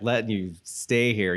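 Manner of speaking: usual speaking voice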